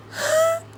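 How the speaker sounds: sharp, high intake of breath; excited gasp. Real, not acted. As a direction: gasp in delight. Excited gasp between actors' names.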